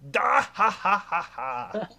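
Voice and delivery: slow, hearty put-on laugh; big laugh. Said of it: "笑っただけじゃない、ダッハッハっつって".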